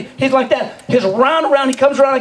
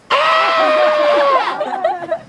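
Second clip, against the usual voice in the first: sister's scream. Retold or imitated.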